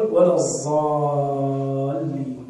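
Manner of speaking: "الضالين" chanted with a z, "zallin"; very slow الضالين with z for ض; dad as heavy z. Shown as a demonstration. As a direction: stretch out "wala z-zaallin" for الضالين. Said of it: "أو يقول".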